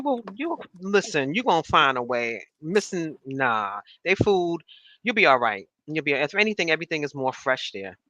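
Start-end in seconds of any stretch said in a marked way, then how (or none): none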